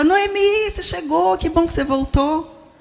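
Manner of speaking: joyful welcome. Retold or imitated. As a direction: greet a returning neighbour warmly. Townspeople greeting Noemi's return. (o povo saudando Noemi, alegre e bem mais agudo)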